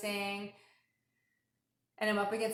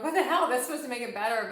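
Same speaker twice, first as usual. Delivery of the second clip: higher, louder exasperated outburst; 4 semitones higher, 7 dB louder than usual. Retold or imitated. Frustrated when reprogramming made things worse, loud exasperation. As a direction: blurt it out, fed up. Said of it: "You know, I'm like".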